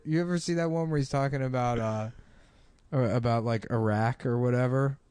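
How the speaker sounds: normal talking voice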